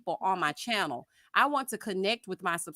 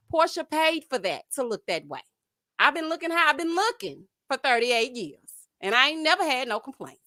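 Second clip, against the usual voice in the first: normal talking voice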